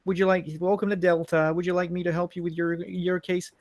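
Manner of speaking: put-on high-pitched American airline-clerk accent; imitated American agent. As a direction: mimic a polite American customer-service agent. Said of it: "this is how the guy was talking".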